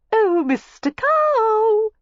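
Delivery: very high, very slow drawn-out call; coy calling out. A narrator's reading. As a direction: sing out to him teasingly. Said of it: "Miss Layton called".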